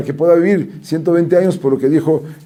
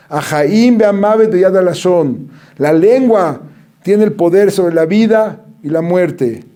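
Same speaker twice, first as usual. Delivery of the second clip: solemn recitation; 3 semitones higher, slower than usual. Acted. Reciting sages' verse.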